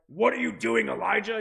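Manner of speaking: loud, rough booming rebuke; angry overbearing God. Shown as a demonstration. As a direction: thunder it down like a harsh overbearing judge. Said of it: "angry and rough and overbearing and powerful".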